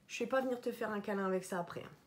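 plaisanterie chuchotée, rapide; chuchoté. Real, not acted. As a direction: souffle la blague en confidence. Plaisante sur l'haleine épicée. (chuchoté en rigolant)

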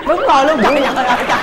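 loud, high burst of agreement; amused exclamation. Real, not acted. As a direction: exclaim over the laughter. Host exclaims amid studio laughter.

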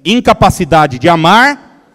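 loud call-and-response phrase, said with the crowd; preacher leads the church in repeating it, loudly; loud repetition. Shown as a demonstration. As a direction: proclaim it loudly for the congregation to repeat. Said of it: "Vamos repetir isso juntos? Diga assim".

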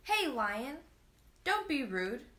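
put-on angry child shouting at a rude lion; boy screaming. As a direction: yell it so the lion can't ignore you. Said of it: "the boy screamed".

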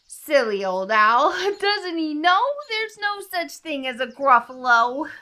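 high, loud, sing-song mouse; gleeful mocking. A narrator's reading. Mouse laughs at silly old owl, gleefully mocking. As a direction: crow over the fooled owl.

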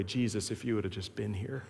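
soft, low, tender lament; tender Mary. Acted. Sweet Mary gently reproaching Jesus, in a tender way. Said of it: "says it in a more tender way".

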